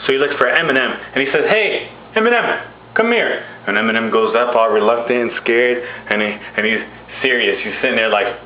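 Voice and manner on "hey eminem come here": high summoning call; God calling someone up; calling someone over. Acted. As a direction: beckon a person over in a raised pitch. God summons [name] from crowd.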